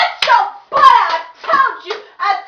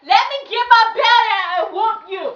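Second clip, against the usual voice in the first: ordinary talk, nothing marked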